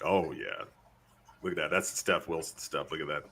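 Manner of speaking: low, soft, playful murmur; color commentary. Pretend. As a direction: give hushed play-by-play in a low voice. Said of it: "just gonna do the color commentary".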